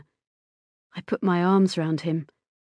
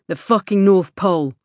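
loud sarcastic comeback; angry retort; 6 dB louder than usual. A narrator's reading. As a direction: toss it back crossly on the way out. Son snaps back while leaving.

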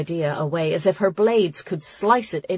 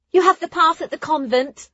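high, louder animated character voice; Ruli, objecting; 11 semitones higher, 5 dB louder than usual. A narrator's reading. Ruli doubting Nona's skill, objecting. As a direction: argue back in a high voice.

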